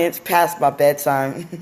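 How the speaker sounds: normal talking voice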